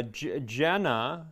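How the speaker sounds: ordinary delivery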